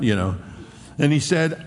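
ordinary talk, nothing marked

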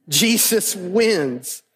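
ordinary delivery

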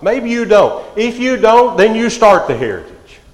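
high, loud preaching push; rally the listeners to start it; rousing appeal. Real, not acted. Preacher urging fathers without godly heritage, loud and high.

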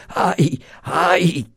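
high-pitched acted moan of pain; faint moaning. A narrator's reading. Guard winded by a punch in the stomach, moaning. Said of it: "a gemer sottovoce".

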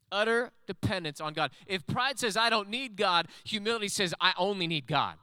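emphatic sermon delivery; preaching. Real, not acted. Preacher building in intensity.

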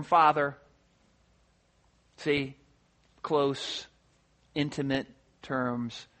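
hushed, low and slow-spaced; intimate. Acted. Preacher dwelling on the word Father, intimate and hushed. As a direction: speak softly and close, with long pauses. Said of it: "the very intimate term".

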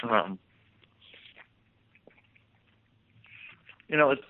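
talking with a mouthful; mouth full. Real, not acted. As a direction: speak through a bite of burrito. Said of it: "Are you eating?".

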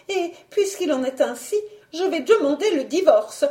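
high-pitched aggrieved wife; retorting baroness. A narrator's reading. Baroness threatens her husband with divorce, high aggrieved retort. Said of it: "ripostait la baronne".

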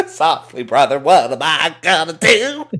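laughing through a gravel-free Hogan line; brother-talk wrestling promo voice, cracking up; hammed-up Hulk Hogan imitation with a laugh; Hogan-style promo, laughing.

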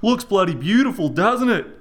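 Rog's Aussie voice. A narrator's reading. Rog admiring the float, oblivious to the fuss. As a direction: play Rog as a cheery, clueless country bloke.

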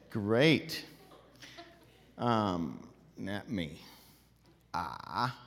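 normal talking voice

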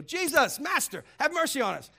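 lepers calling out. Retold or imitated.

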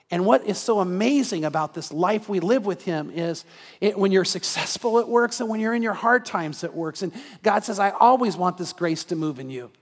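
loud, rising, urgent sermon delivery; full-force preaching. Acted. Pastor urging listeners to commit, preached loud and fervent. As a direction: push the appeal hard to the congregation.

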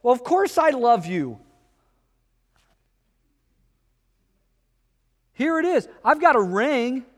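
defensive spouse. Retold or imitated. Apathetic spouse answers defensively.

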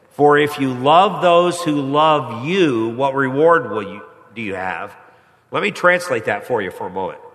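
ordinary delivery

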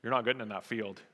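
lightly voiced farmer, unbothered and low; laconic farmer. Farmer turning away the government man, low and laconic. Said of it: "The farmer with straw in his mouth goes".

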